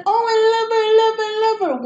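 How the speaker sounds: high, loud gushing mom impression; doting mom imitation. Imitating mom gushing over the baby niece, high and loud. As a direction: gush like a doting grandma, hammed-up. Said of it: "she's like".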